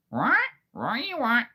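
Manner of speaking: high, squawking parrot voice. Retold or imitated. For the nieces, a loud squawking parrot. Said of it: "I can do a parrot".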